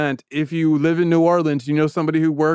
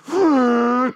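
sharp angry inhale; 8 semitones higher than usual. Retold or imitated. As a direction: suck air in through the nose. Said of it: "sucks all of the air out".

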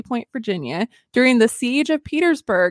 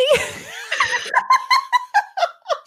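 bursting out laughing. Real, not acted. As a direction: crack up, giving up on a straight face. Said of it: "trying to say that with straight face".

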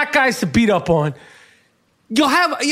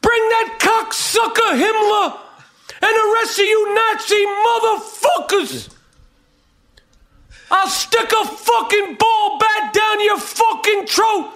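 furious shout; 8 semitones higher than usual. Acted. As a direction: bellow it right in his face. Ranting at imagined Nazis.

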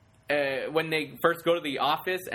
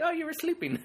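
teasing laugh; 12 semitones higher than usual. Retold or imitated.

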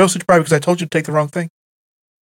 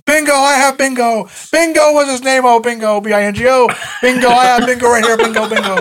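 mock bingo winner; 6 semitones higher than usual. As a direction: imitate an old man crowing over a bingo win. Retold mock bingo winner calling out.

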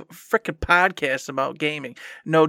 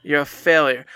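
ordinary delivery

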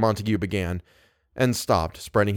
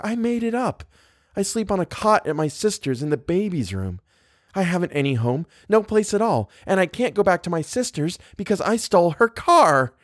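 put-on higher-pitched, girlish confession; earnest confession; 9 semitones higher than usual. A narrator's reading. Guest confessing she has no home, earnest and high. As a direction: let it spill out high and earnest.

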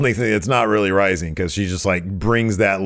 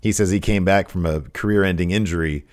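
usual speaking voice

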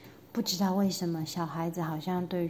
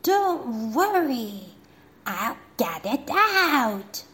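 eager general; 4 semitones higher, 4 dB louder, slower than usual. Acted. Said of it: "said the general".

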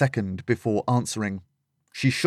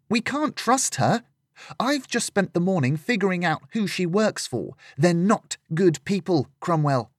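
put-on higher girl's voice, urgent and wary; young Lillian, distrustful; 8 semitones higher than usual. Lillian warns Cromwell about Cassandra, higher and distrustful. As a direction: pitch up into a young woman's protest.

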